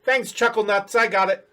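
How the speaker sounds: high goblin voice, offhand. Acted. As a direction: toss it back in a goblin voice. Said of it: "he turns around and says".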